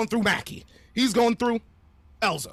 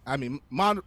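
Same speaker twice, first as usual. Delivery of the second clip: ordinary talk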